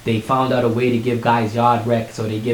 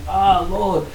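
high-pitched exasperated appeal; retold sigh of mild frustration; a touch of exasperation; mildly frustrated; 8 semitones higher than usual. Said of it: "I'm a little frustrated".

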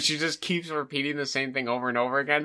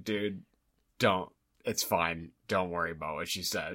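reassuring cousin; 7 semitones lower, 8 dB quieter than usual. Retold or imitated. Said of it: "I'm like".

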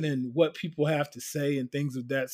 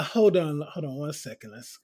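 usual speaking voice

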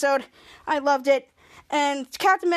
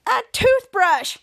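slow, higher and louder; drawn-out announcement; 7 semitones higher, 5 dB louder, much slower than usual. Retold or imitated. Miriam presents the pencil, slow and high. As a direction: stretch it out grandly. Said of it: "Christmas Miriam says".

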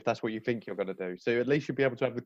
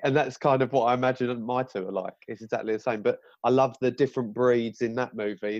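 ordinary talk, nothing marked

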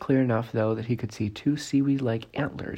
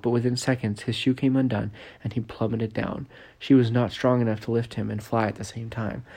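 usual speaking voice

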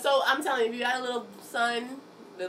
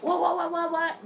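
higher, rapid repeated what; imitating the son; 8 semitones higher, 4 dB louder than usual. Son runs out at the neighbors, imitated higher and quicker. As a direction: act the kid popping out playfully. Son acted bursting out with gun.

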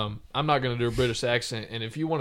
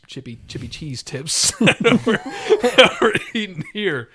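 laughing; 8 semitones higher, 7 dB louder than usual. Real, not acted. Laughing at his own mix-up.

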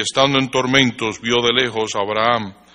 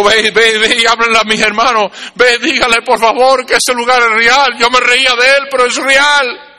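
high, loud, frantic begging; desperate shouted plea; 10 semitones higher, 8 dB louder than usual. Acted. Rich man begging someone to warn his brothers, desperate shouted plea. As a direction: cry out a warning at full force.

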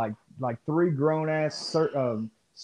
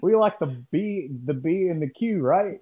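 louder, higher mock voice seeking agreement; robot posing as human; 5 semitones higher, 4 dB louder than usual. Mock Zuckerberg convincing others he's human, raised insistent voice. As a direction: insistently act human, checking with each "right?".

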